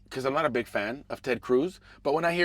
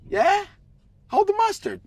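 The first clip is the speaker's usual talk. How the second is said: much higher, pushing Hold the mustard; insistent request; 6 dB louder than usual. Retold or imitated. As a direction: squeak Hold the mustard up high and pleading. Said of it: "I'm like".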